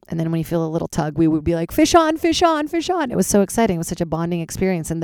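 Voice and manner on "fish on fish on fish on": high, excited calling out. Retold or imitated. Calling to friends on the boat that a fish is hooked, high and excited. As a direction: sing it out like you've hooked a fish.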